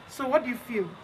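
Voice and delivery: ordinary delivery, nothing marked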